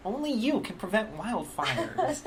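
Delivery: high, slow mock ad voice; mocking millennial spokesman, high and stretched; young millennial guy. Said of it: "probably millennial guy who's just like".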